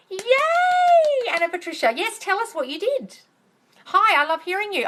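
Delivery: high gushing excitement; excited cheer. Real, not acted. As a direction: celebrate the viewer's win. Cheering viewer's success.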